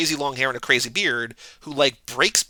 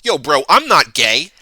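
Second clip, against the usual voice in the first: hammed-up bro denial, louder; mock bro voice; 9 dB louder than usual. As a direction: play the touchy dude insisting he's straight. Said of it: "every dude in this movie basically be like".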